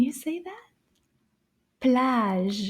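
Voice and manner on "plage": "Plage" with a drawn-out vowel and lingering ending; slower demo. Shown as a demonstration. Teacher modelling the word for children, slow and careful. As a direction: stretch out "Plage" for repeating. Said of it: "we'll say it a little faster".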